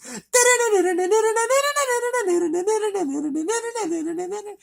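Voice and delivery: high nasal-free scat of the main riff, held high and loud; humming the guitar riff. Retold or imitated. Reviewer vocalizes the song's main riff for viewers, high scat. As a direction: sing the opening riff as nonsense syllables, way up high. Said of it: "I should attempt to sing it".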